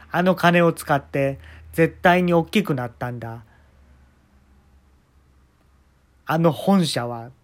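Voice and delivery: slow, loud, proud declaration; grandiose boast. Retold or imitated. Jacob proudly boasting about the company, slow and loud.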